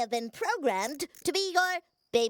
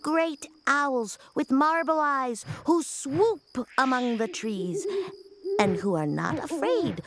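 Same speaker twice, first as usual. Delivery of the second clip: bedtime storytelling voice. Acted. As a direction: read it like a gentle bedtime story.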